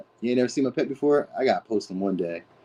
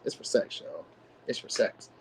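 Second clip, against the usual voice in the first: whisper; 8 dB quieter than usual. Acted. Confiding the tarot reading's punchline.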